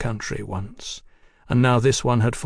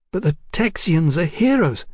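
higher-pitched, eager woman's voice; desperate woman; 6 semitones higher than usual. A narrator's reading. Woman desperately trying to placate the Texian, eager. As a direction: flatter him in a raised voice.

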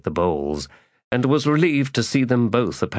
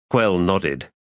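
plain reading voice

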